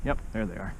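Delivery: usual speaking voice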